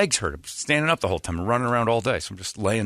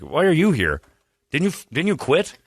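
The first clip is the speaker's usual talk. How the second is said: higher, louder incredulous questioning; retold bafflement; 7 semitones higher, 4 dB louder than usual. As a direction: imitate people baffled by his return. Others quoted, baffled at [name]'s return.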